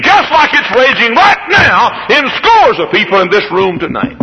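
high and strong; preaching cry. Acted. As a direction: point it at the room. Preaching to congregation.

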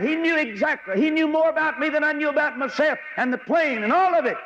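rising preacher's fervor, driving each line; preaching at full force. Acted. Preaching climax.

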